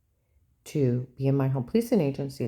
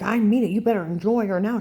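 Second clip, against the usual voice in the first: higher, louder imitated threat; insistent taunt; 5 semitones higher, 6 dB louder than usual. Ex-husband threatening the crying boy, insistent and raised. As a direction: drive the warning home, imitating.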